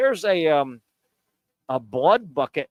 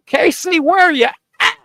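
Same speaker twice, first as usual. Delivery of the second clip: high, loud call across the room; 12 semitones higher, 8 dB louder than usual. Real, not acted. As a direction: yell for Casey to jump in. Calling out for co-host Casey.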